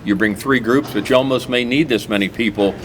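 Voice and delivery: ordinary talk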